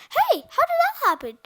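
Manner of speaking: high, loud surprise; startled storybook character; astonished question. A narrator's reading. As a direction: exclaim in baffled amazement. Character amazed at narrow escape.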